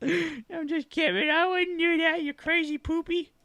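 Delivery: very high playful coo; very high cooing tease. Real, not acted. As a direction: tease the dog sweetly. Teasing dog baby talk.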